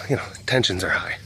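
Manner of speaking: normal talking voice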